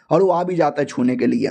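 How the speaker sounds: usual speaking voice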